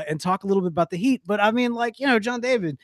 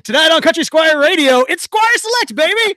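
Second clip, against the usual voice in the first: radio announcer; 11 semitones higher, 8 dB louder than usual. Acted. Radio show opening announcement.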